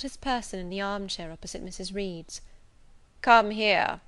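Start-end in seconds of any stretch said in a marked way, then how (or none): 3.2-4.0 loud, solemn summons; stern command. A narrator's reading. Seated gentleman summoning the little girl, stern. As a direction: order a child over with grave authority.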